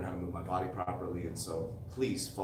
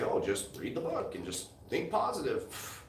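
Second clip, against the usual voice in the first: slow, higher mock-guru voice; sarcastic imitation of a fake guru; mocking quote; 4 semitones higher than usual. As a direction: parody the empty advice, drawn out. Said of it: "they're gonna say".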